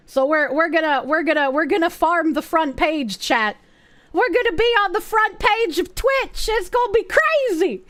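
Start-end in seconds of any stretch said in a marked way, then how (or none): 4.1-7.8 excited. Real, not acted. Thrilled about Twitch front page.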